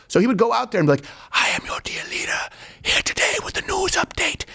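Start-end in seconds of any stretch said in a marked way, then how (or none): 1.3-4.4 high eerie whisper of a dictator; spooky dictator whisper. Retold or imitated. A dictator whispers his radio news update to the nation. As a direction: whisper the broadcast like a creepy ghost. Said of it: "allegedly talks like this".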